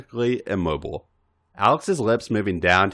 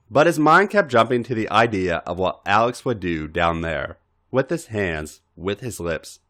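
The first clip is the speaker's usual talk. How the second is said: ordinary delivery, nothing marked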